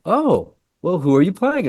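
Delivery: high, curious questioning voice; imitated co-star. Co-star asking newcomer about the role, imitated high and curious.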